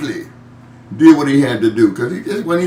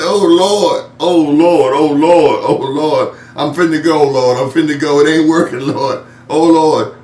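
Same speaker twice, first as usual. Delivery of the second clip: panicked plea; 3 semitones higher, 6 dB louder than usual. Retold or imitated. Heart patient crying out to the Lord in pain, loud panic. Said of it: "And I said".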